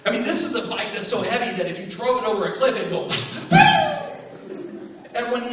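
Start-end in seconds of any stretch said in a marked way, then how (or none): 3.1-5.1 high loud laughing burst; crash noise laugh. Retold or imitated. Describing the heavy bike over a cliff, high laughing burst. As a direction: laugh it out like a wreck.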